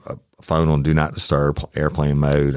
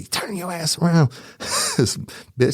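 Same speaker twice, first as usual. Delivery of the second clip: higher, far-off mock threat with a laugh; Stephanie threatening; 12 semitones higher, much slower than usual. Stephanie snapping at the sister in court, higher mock threat. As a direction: play the fierce aunt snapping at the lady. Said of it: "I hear Stephanie say".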